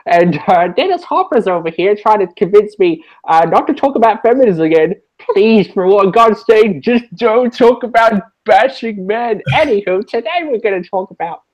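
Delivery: high, loud comic presenter parody; put-on dub voice. Shown as a demonstration. Host dubbing over a TV presenter to mock the show, high put-on voice. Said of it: "no, or just like".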